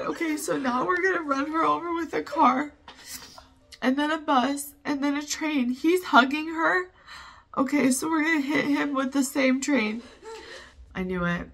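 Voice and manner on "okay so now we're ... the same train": high-pitched, upset protest; tearful. Real, not acted. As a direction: keep commenting through the tears. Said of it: "such a fool for crying".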